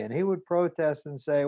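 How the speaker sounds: ordinary delivery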